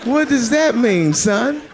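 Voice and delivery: high, slow, incredulous; baffled outburst. Retold or imitated. Comic to stranger on street, high and baffled. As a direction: act stunned by the reply. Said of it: "retold reaction".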